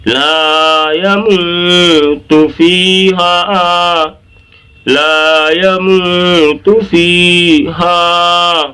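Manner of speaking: slow chant of لا یموت فیہا with held vowels; stretched recitation. Shown as a demonstration. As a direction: stretch laa, moo, fee and haa in لا یموت فیہا. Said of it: "اس طرح سے".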